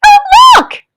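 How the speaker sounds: put-on high excited child voice; high, eager child's cry; Toto crying out. A narrator's reading. Toto to Mary, spotting the tree: high excited cry. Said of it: "cried Toto".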